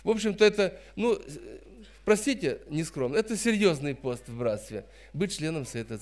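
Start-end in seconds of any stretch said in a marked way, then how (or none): none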